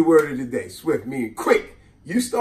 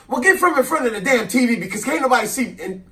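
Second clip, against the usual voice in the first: higher, louder scolding aunt; 8 semitones higher, 4 dB louder than usual. Retold or imitated. Aunt scolds the kid blocking the TV, higher and louder. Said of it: "her response would be".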